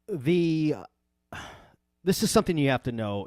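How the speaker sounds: usual speaking voice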